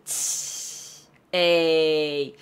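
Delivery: long hiss, then low drawn-out "ey"; dismissive, stretched-out pshaw; scoffing disbelief. Shown as a demonstration. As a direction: scoff like you don't buy it. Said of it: "이런 느낌이어서".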